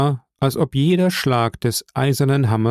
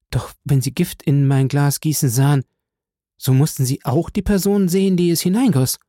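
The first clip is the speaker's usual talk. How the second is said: put-on higher, anxious young woman's voice; frightened; 4 semitones higher than usual. A narrator's reading. Valentin questions the count, growing frightened. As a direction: voice climbing with dread. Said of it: "im höchsten Maße erschrocken".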